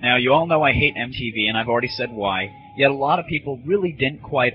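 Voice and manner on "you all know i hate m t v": normal talking voice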